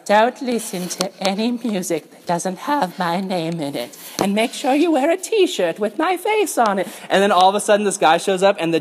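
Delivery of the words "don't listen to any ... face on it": a hint of a parody Jesus; mock Jesus voice. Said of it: "he's like".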